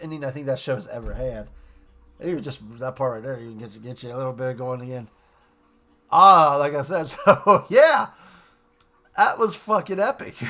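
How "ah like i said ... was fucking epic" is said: laughing. Real, not acted. Excited laughing recap.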